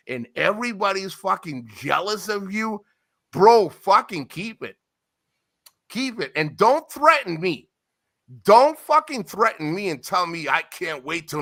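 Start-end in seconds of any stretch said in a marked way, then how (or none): none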